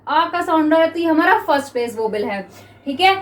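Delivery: ordinary talk, nothing marked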